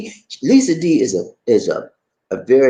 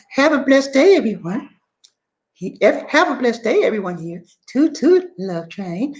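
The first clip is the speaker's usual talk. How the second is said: imitating Sincerely's sing-song greeting; 13 semitones higher than usual. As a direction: mimic her bright sign-off, up high. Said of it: "You need to talk like Sincerely".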